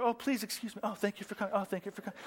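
soft, mock-courteous usher at the door; kindly, polite. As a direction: murmur quick thanks as you show guests out. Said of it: "he kindly put them out".

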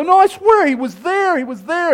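high, loud pleading to be believed; excited insistence. Acted. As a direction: swear it urgently to a doubting friend. Disciples insist to doubting Thomas.

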